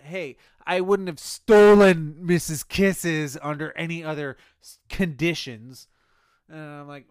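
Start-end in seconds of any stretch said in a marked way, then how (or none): 0.0-5.9 loud, indignant outburst; retold snap, blasting out the words; snapped protest. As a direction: shout it in self-defence. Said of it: "I snapped. I was like".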